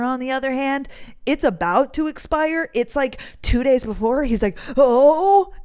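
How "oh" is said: high, loud rising "oh"; hammed-up alarmed reaction; mock alarm. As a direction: imitate his overblown worry. Boyfriend's retold alarm at expiry date.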